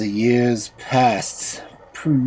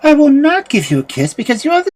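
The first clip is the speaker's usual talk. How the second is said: put-on girl refusing; 6 dB louder, faster than usual. A narrator's reading. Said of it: "cried Prunella".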